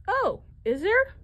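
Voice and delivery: normal talking voice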